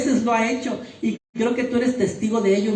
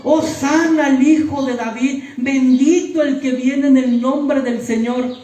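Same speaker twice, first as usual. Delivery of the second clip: higher and louder crowd acclaim, slowing at the end; crowd shouting; 5 semitones higher, 6 dB louder than usual. Retold or imitated. Preacher voicing the crowd that hailed Jesus, loud and high. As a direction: proclaim it like the cheering crowd. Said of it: "que estaba gritando".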